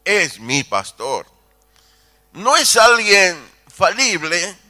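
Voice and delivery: loud, ringing pulpit declamation; preaching at full force. Acted. Preacher booming to the congregation about God, full-force preaching. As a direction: proclaim it to the back pews.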